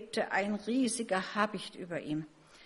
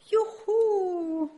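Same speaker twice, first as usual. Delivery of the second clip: high, drawn-out joyful call, building to loud; exultant whoop; 11 semitones higher, 7 dB louder than usual. A narrator's reading. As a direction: whoop as if suddenly airborne. Fledgling's first flight in story. (hoher, lang gezogener Jubelruf)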